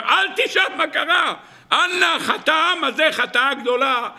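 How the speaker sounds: high, hammed-up cry of disaster; inflating the matter. Moses pleads to God over the sin, exaggerated outcry. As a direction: wail it out, blowing it up. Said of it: "משה רבינו מנפח את העניין".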